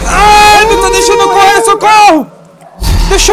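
high, loud panicked cry for help; trapped and terrified; being chased. Real, not acted. Monster blocking the player.

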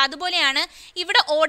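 normal talking voice

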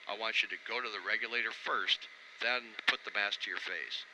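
usual speaking voice

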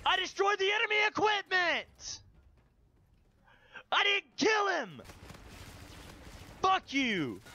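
high-pitched indignant yelling; outraged protest. Real, not acted. Player whose attack failed, outraged shouting. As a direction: yell the complaint at the game.

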